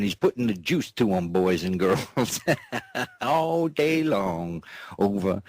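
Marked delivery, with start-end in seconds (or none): 2.4-3.1 squealing laugh. Real, not acted.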